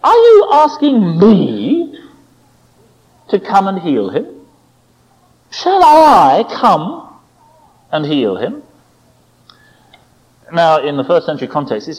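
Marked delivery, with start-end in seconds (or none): 0.0-8.6 high, slow stress on "me" and "I", rising; incredulous question. Retold or imitated. Jesus probes the Gentile, questioning with high drawn-out "me". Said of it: "is to put a question mark".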